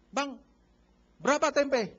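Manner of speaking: loud, pushy call to the vendor; loud, pushy haggling over the price of tempe; haggling buyer. Retold or imitated. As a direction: call out to the vegetable seller to bargain hard.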